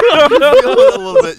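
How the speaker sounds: very high, loud laughing words; laughing hard. Real, not acted. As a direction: squeal through laughter.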